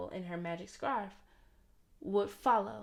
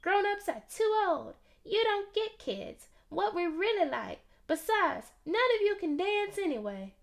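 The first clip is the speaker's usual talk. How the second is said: put-on much higher little kid's voice; piping little boy; 10 semitones higher than usual. A narrator's reading. Tiny Theo telling Sunday grown-ups can't dance, high and piping. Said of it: "Tiny Theo piped up".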